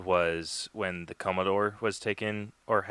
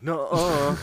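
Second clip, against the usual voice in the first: petulant denial; 8 semitones higher, 7 dB louder than usual. Retold or imitated. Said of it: "throws, like, a big old bitch fit over it".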